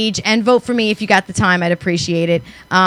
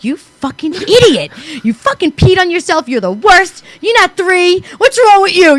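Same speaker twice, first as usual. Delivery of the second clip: higher, exasperated self-scolding; scolding herself; 8 semitones higher than usual. Retold or imitated. Woman scolding herself after peeing on her leg, exasperated. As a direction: berate yourself like a fed-up parent. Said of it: "I'm like".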